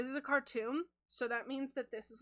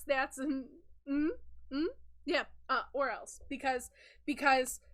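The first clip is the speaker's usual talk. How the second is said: ordinary delivery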